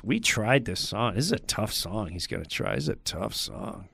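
low, very soft mumbled caveat; imitating a hesitant introduction; doubtful emcee. [name]'s doubtful introduction, acted.

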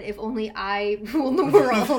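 loud laughing quote. Retold or imitated. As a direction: laugh through the words. Quoting herself with a laugh.

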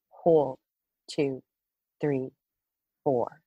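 slow, paced breathing count. Shown as a demonstration. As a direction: count the breaths slowly and calmly. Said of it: "go through that twice with our listeners".

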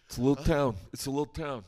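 low, mumbled tough-guy voice; a hint of a gruff movie gangster; Robert De Niro. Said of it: "Robert De Niro's here".

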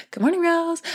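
sing-song morning greeting to herself; high sing-song greeting. Shown as a demonstration. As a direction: chirp "Good morning, rose." up high like a cheery wake-up call. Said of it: "I say".

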